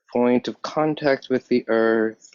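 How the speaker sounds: usual speaking voice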